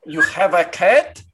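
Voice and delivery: put-on heavy Russian-accented doctor; thick Eastern European accent, slightly congested. Doctor sniffing for burnt hair, in a Russian accent. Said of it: "very Russian man".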